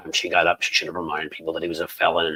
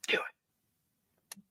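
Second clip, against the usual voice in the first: whisper; 13 dB quieter than usual. Real, not acted. Urging the host to act.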